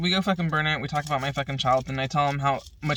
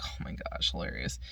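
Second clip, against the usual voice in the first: hushed, breathless whisper; whispered aside; 13 dB quieter than usual. Retold or imitated. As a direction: whisper it like a secret. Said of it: "And I'm like".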